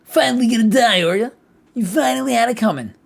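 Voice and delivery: high, loud mocking voice; hammed-up high sneer. Reread taunt louder.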